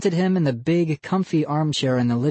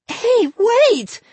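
put-on much higher, louder girl's scream; 6 dB louder than usual. A narrator's reading. Molly screams to halt the show, shrill and loud. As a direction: stop everyone cold. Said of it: "Molly screamed".

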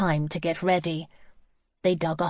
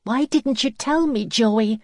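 high-pitched, distressed plea; agonised reproach; 5 semitones higher, 4 dB louder than usual. A narrator's reading. Lizzie asks Joey why he hid it, agonised. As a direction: demand an answer in dismay. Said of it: "in an agony of doubt".

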